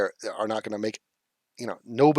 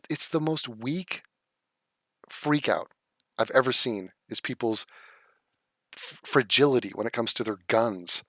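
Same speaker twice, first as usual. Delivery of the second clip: normal talking voice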